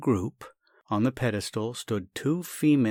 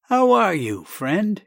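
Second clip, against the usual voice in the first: much higher, louder jovial hello; hearty greeting; 9 semitones higher, 8 dB louder, slower than usual. Acted. Doctor arriving at the lawyer's, loud hearty greeting. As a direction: greet him with big cheer.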